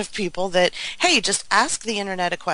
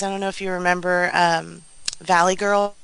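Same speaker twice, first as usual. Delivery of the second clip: ordinary delivery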